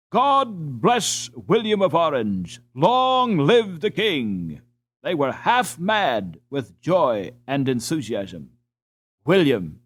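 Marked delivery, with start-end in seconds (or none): 0.1-4.6 slow, higher, ringing cheer of a joyful crowd; crowd shouting. Acted. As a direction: call it out slowly like a cheering crowd. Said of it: "the people were shouting".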